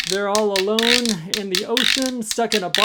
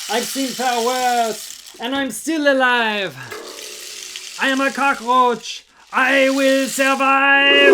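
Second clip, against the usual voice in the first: defiant yell; 7 semitones higher, 5 dB louder than usual. Acted. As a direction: shout your defiance at the storm. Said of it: "He yells into the storm".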